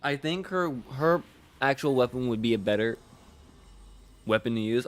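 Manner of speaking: talking through hiccups and burps; hiccuping. Real, not acted. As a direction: speak between hiccups. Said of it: "I'm hiccuping and burping at the same time".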